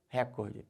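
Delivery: "recorde" as RÉcorde, open E stressed; wrong first-syllable stress. Shown as a demonstration. ("recorde" como RÉcorde, tônica errada no RÉ)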